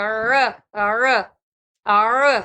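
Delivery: ار with a tight throat ع; throaty ain. Shown as a demonstration. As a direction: push ار from the throat. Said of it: "اس طرح سے پڑھنا ہے".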